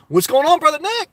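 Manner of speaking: high, bright hello to a friend; lively shout-out to a viewer; excited greeting. Real, not acted. Greeting a chat viewer warmly.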